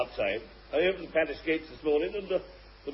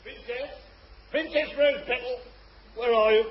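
royal herald; 9 semitones higher, 3 dB louder than usual. Acted. Courtier calling for the princess.

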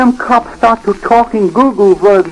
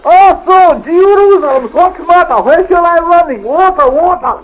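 startled panic; 8 semitones higher than usual. Acted. Startled by the sudden disguise.

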